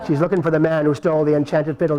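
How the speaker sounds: plain reading voice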